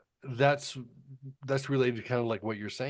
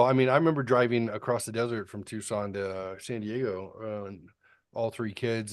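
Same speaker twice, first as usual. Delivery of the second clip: ordinary delivery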